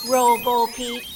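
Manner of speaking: ordinary delivery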